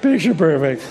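flat wry echo of "Picture perfect"; dry ironic echo. Real, not acted. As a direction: let the irony settle. Ironic echo of own words.

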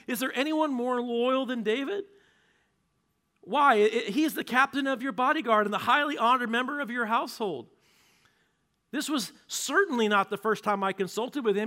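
high, loud frantic pleading; pleading priest. Acted. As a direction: beg the king to hear you out. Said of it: "Ahimelech's like".

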